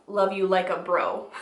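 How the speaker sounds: ordinary delivery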